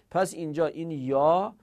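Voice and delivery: ordinary talk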